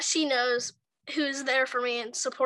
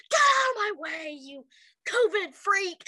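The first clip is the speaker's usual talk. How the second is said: mock angry shout; 6 semitones higher, slower than usual. Rude passerby shouting at a stranger to move, mock angry yell. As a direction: imitate a rude passerby barking at someone.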